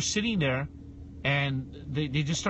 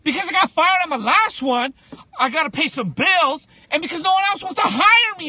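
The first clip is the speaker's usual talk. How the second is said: loud, high exasperated outburst; blunt honest answer; 10 semitones higher, 8 dB louder than usual. Pretend. Guest voices the honest interview answer, loud outburst. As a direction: vent the answer you can't say. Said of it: "imagined blunt interview answer".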